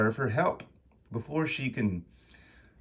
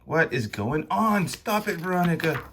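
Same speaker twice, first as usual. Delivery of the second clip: higher-pitched urgent demand; alarmed confrontation; 8 semitones higher than usual. Acted. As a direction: plead urgently for it to stop. Konstantin confronts Veronica.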